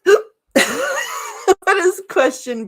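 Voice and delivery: high, very slow, giggling through hiccups; laughing with hiccups. Real, not acted. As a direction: drag it out while laughing. Asks again through hiccups.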